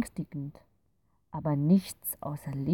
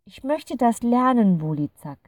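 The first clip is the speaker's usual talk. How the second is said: higher, louder, firm resolve; determined; 7 semitones higher, 9 dB louder than usual. A narrator's reading. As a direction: make up your mind out loud. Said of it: "sagt Clara entschlossen". (entschlossen und bestimmt)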